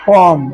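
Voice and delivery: "कॉम" ending on m; falling, model "कॉम" without b; silent b. Shown as a demonstration. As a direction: close "कॉम" on m, no b. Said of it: "बी silent रहेगा".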